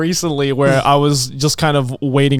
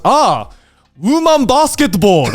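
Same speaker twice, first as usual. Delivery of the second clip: put-on slow, high Japanese-accented exclamation; imitated Japanese accent; 6 semitones higher, 4 dB louder, slower than usual. Japanese shop guy naming what Australia means, imitated Japanese accent. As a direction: proudly announce it in a mock Japanese accent. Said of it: "This dude legit goes".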